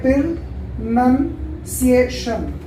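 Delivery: प्रोनाउंसिएशन broken into slow separate syllables; in Silben zerlegt. Shown as a demonstration. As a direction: say प्रोनाउंसिएशन piece by piece, drawn out. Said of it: "उसको break down कर लो".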